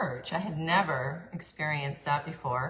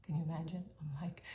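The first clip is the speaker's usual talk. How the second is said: usual speaking voice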